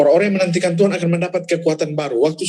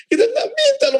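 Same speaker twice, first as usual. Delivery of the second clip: laughing disbelief. Real, not acted. Amazed at unasked gifts.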